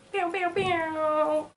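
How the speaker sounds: high, drawn-out "pew" noises; vocal sound effects. Pretend. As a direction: imitate cheesy sound effects with your mouth. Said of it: "Sound effects".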